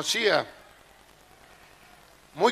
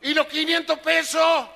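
much higher, loud shout across the street; waiter shouting; 9 semitones higher, 4 dB louder than usual. Retold or imitated. Waiter shouts at the fleeing customer in front of tourists, loud high yell. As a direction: holler after him for the unpaid bill. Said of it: "me gritaba".

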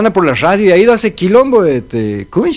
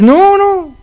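put-on much higher, slightly faster old woman scolding; old wife scolding; 3 semitones higher than usual. Old wife turns down her husband's advances. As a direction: tease him off with a shrill-pitched no.

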